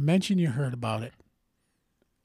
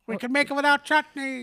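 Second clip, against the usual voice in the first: high put-on waiter voice, begging them to stay; mock pleading waiter; 10 semitones higher, 3 dB louder than usual. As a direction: play the desperate waiter, pitched up.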